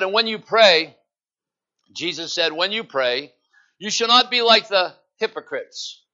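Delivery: loud, ringing scripture reading; scripture read aloud. Acted. Pastor reading Matthew to the church, loud and ringing. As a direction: proclaim the verse to the church.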